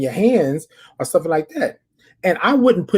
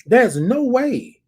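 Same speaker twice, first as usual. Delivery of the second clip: higher, pointed telling-off, slowing at the end; retold telling off; 7 semitones higher than usual. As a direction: firmly tell the man to back off. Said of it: "like I told him".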